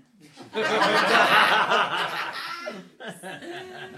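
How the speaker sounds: long high hearty laugh; loud laugh. Real, not acted. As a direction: burst out laughing. Laughs at own bit.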